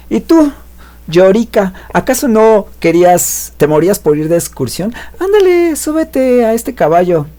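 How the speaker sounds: high, mocking girl voice, pushed up; teasing blonde. Blonde daring the boy to ride, high and mocking. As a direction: taunt him in a high, sing-song dare.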